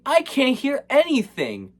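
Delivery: high mock film-kid voice; lightly voiced movie kid; imitated hero boy. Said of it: "Hero Boy's like".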